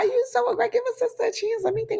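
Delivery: very high, squeaky comic plea; mock pleading. Customer to aggressive soup seller, mock pleading. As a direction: beg for patience way up high.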